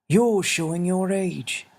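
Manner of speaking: put-on a small old man asking kindly; little winged man. Xavier's voice.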